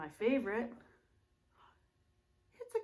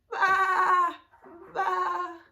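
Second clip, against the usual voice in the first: high loud baa; bleating sheep; 8 semitones higher, 10 dB louder than usual. Shown as a demonstration. Teacher bleating like a sheep for kids. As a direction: bleat like a lamb.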